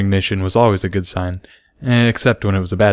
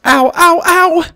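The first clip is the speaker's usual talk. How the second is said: put-on very high, loud little girl yelps; high childlike ow ow ow; pained cries; 7 dB louder, slower than usual. A narrator's reading. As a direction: yelp in sudden pain. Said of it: "Dinky cried".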